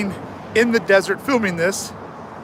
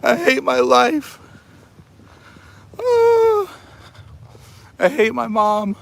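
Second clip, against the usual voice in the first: mock despair; 6 semitones higher, slower than usual. Mock desert breakdown, crying.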